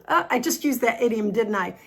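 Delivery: ordinary talk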